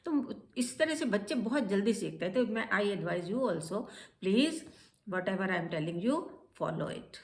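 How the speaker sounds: ordinary talk, nothing marked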